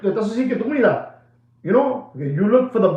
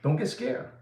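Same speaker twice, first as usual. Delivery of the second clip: hushed reassurance; 11 dB quieter than usual. Real, not acted. Reassures viewers.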